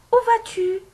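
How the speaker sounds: très haut et fort, montée de question; question modèle. Shown as a demonstration. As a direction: lance la question bien haut, pour la classe. Relit la question exemple. (question posée très haut et fort)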